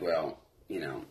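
low, slow and soft imitated counselor; soft counselor talk. Counselors easing a kid toward treatment, soft and low. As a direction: ease into it quietly, voice dropped low. Counselors gently breaking news to him.

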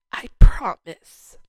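slow, tender reassurance; sweet reassurance. Retold or imitated. God gently reassuring her at the altar, slow and tender. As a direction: promise it slowly and gently, like comforting a child. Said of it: "I heard him so sweetly say".

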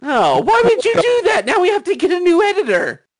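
high, loud mock shriek; shocked outcry. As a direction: wail it in fake shock. Mock outrage at the death.

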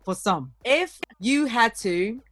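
ordinary talk, nothing marked

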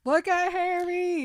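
urgent cry of a worried friend; alarmed Hermione. Retold or imitated.

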